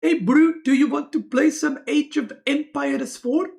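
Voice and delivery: loud, high mock South African; exaggerated South African accent, hailing a buddy. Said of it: "that's how they pronounce words".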